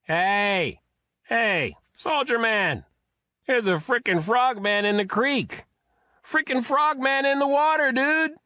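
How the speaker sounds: put-on high, loud drunk shouting; drunken yelling. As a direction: yell like a wasted bum. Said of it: "drunken bums under the bridge were still yelling".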